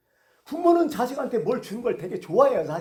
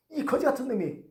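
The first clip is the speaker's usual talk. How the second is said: mock rebuke, pitched up; acted bystander's raised, reproachful voice; scolding; 6 semitones higher, 3 dB louder than usual. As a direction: tell off a noisy beggar indignantly. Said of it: "꾸짖는 거예요".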